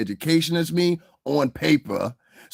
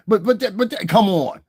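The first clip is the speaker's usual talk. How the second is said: higher, louder stammered protest and challenge; mock parent-child scuffle; 8 semitones higher, 5 dB louder than usual. Parent playfully squaring up with the kid, high loud mock scuffle. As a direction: act out a mock boxing match, raised voice.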